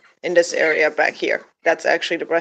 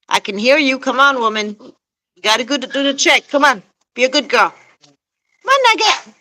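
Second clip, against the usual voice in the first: coaxing call; 6 semitones higher, 5 dB louder than usual. Real, not acted. Coaxing the hen out loudly.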